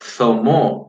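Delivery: zomó with the z between the teeth, like English th; interdental z. Shown as a demonstration. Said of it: "Tenemos la Z".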